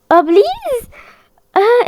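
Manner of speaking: high anxious begging; pleading in fright; frightened plea. Real, not acted. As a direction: beg as the trap shuts. Begging while traps close.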